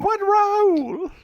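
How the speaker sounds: put-on high, slow, loud old lady asking. Actress hears Downton Abbey is calling, old lady voice. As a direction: ask eagerly in the old lady voice. Playing along with mock phone call.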